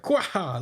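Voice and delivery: high, quick "kwa-ha" laugh; hammed-up villain laugh; mock cackle. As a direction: burst out with a cartoon cackle. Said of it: "he's like".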